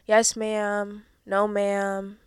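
loud imitation of a southern-accented kid's polite replies; southern accent, loudly mimicked; childhood southern accent. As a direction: recite it like a well-drilled southern kid. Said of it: "I did have a southern accent".

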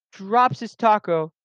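usual speaking voice

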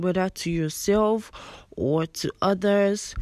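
ordinary talk

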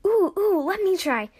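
high, loud, eager burst; excited kid wanting a go; eager exclamation. A narrator's reading. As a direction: jump in begging for a turn. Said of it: "Jax exclaimed".

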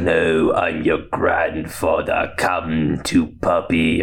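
low, slow villain impression; Palpatine. Palpatine insisting to Rey, low slow villain. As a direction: drawl it low like a sinister old emperor. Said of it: "And he's like".

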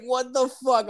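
high-pitched, amused disbelief; retold incredulous outburst. Reacting to the girlfriend's confession, high incredulous outburst. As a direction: pitch it way up, as if appalled.

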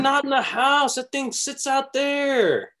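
high, slow scolding. Retold or imitated. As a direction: boss them around about the bird. Mock scolding about falcon at home.

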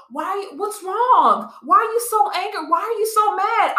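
high, sing-song mock concern; high needling whine; mocking nosy questioner. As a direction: mimic people pestering her to smile. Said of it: "I'll get told".

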